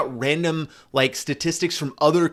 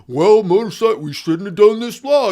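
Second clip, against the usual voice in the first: higher, louder mocking impression of a police spokesman; mock cop voice; 7 semitones higher, 8 dB louder than usual. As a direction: ham up a clueless cop excusing himself. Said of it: "we're just gonna be like".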